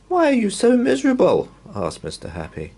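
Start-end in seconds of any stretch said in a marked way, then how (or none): 0.1-1.5 high, loud and bright hello; cheery greeting. Acted. Cheerful visitor greeting a gloomy stranger, loud high hello.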